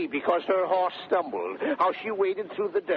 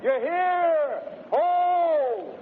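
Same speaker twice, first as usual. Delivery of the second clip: calling out; 10 semitones higher, much slower than usual. Acted. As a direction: shout the servants forth. Grumio summoning the servants.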